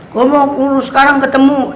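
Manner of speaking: high, imitating a stubborn child; mock impatient insistence; insistent demand.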